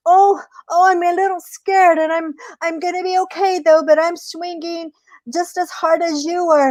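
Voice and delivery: high voice, feigning a little fear; scared trickster rabbit. Acted.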